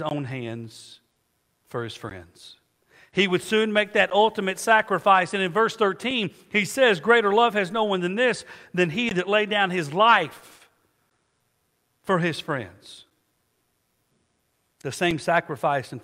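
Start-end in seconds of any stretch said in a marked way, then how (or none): 3.1-13.0 preaching at full force. Acted. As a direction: lift the congregation with emphasis. Preacher rises reading scripture.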